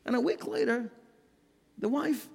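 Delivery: ordinary talk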